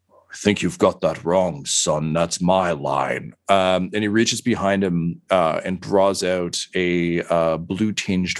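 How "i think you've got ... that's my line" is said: low, world-weary inquisitor, slowing at the end; world-weary villain. Acted. Inquisitor answers the charging attacker, world-weary and low. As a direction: play a tired, stocky veteran talking down to a youngster. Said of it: "that world weary".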